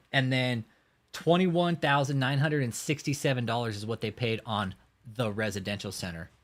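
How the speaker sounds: ordinary talk, nothing marked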